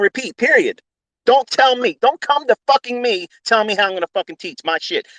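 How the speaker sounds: mock tirade at the whiners; angry rant. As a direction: tear into the complainers, pitched high. Rant at complaining students.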